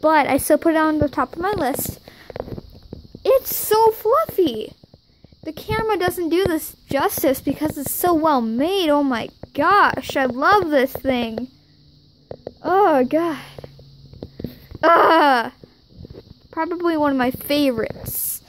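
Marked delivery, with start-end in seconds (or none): 3.2-4.7 high, slow, gushing squeal; excited squeal. Real, not acted. Squealing over plush. 14.8-15.5 short delighted squeal; excited squeal. Real, not acted. Squeal over gift.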